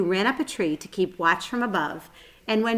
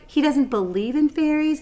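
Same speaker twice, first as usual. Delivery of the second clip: higher, louder disbelieving repeat; incredulous Lady Sue; 8 semitones higher, 5 dB louder than usual. Acted.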